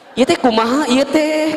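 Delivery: high accusing question; mock interrogation. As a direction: press him, high and pointed. Wife interrogates husband. (istri menginterogasi suami)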